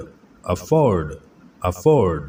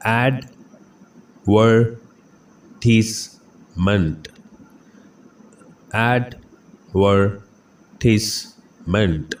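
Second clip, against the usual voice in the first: Advertisement stretched out very slowly, every syllable clear; teacher slowly modelling the first pronunciation for students; much slower than usual. Shown as a demonstration. Slow, careful pronunciation drill. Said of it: "इसकी पहली pronunciation है".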